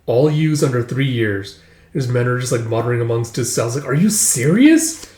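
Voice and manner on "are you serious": incredulous question, pitched up and drawn out; stretched, high "are you serious?". Retold or imitated. Men reacting to the offer, incredulous.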